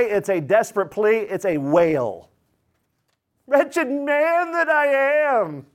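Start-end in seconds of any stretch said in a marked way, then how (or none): 3.5-5.7 high, slow wailing cry of Wretched man. Acted. Preacher voicing Paul's wail to the congregation, high slow wail. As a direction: stretch it out like a desperate wail. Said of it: "He's just wailing".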